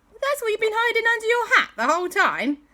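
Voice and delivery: high, loud, incredulous question; astonished listeners, voiced high and loud; astonished outburst. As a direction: squeal it in acted amazement.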